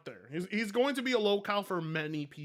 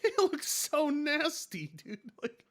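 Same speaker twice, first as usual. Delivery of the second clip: disgusted; 7 semitones higher, 4 dB louder, slower than usual. Real, not acted. Disgusted exaggerated reaction.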